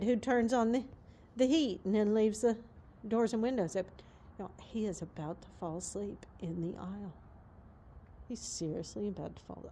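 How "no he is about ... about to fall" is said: softer hushed low voice. Real, not acted. Owner watching her horse nod off, hushed. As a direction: drop the voice so he can sleep.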